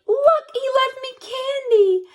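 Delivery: very high excited squeal; delighted child. Acted. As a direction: squeal over the candy.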